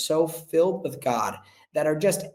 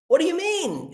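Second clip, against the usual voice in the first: surprised; 13 semitones higher than usual. Retold or imitated. Janitor puzzled by the twenty dollars, high surprised. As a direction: play the janitor caught off guard, pitch jumping up. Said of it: "And he's like".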